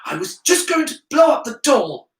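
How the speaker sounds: put-on high, loud boyish protest; indignant young pupil; protesting schoolboy. A narrator's reading. Harry protests his intention.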